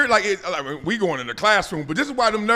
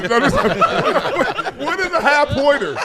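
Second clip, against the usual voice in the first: laughing; 6 semitones higher, much slower than usual. Real, not acted. Laughing disbelief at the joke.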